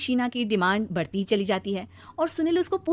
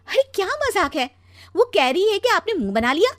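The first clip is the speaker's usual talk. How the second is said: high-pitched, scolding disbelief; indignant; 10 semitones higher, 6 dB louder than usual. Acted. Wife confronting husband about his sulky face, indignant. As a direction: confront him, getting louder.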